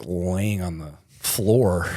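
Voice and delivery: usual speaking voice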